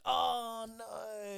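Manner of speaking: dismayed. Retold or imitated. Reptile guy sees dead pet.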